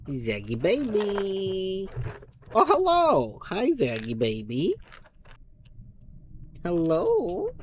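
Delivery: cooing pet voice, slow and high. Real, not acted. To her cat Zag, cooing baby talk.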